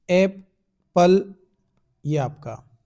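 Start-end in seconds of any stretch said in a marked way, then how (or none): 0.1-1.3 "Apple" slow and loud in two beats, एप, pause, पल; divided into syllables. Shown as a demonstration. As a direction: break "Apple" apart as एप - पल. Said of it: "consonant से पहले divide होता है". ("Apple" को धीमे और ज़ोर से, एप - पल में तोड़कर)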